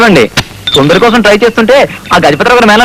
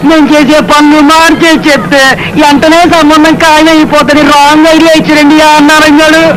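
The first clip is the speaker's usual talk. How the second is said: tearful complaint; 7 semitones higher than usual. Acted. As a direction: whimper out the sad tale like a wronged man. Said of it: "ఏం జరిగిందో ఏడవకుండా చెప్పండి". (ఏడుస్తూ ఫిర్యాదు)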